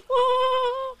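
tiny high giggle; little baby laugh; squeaky giggle. A narrator's reading. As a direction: Roo giggling in the water. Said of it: "squeaked Roo".

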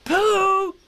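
high, loud calling shout. Acted. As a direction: holler the name joyfully. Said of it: "shouted Christopher Robin".

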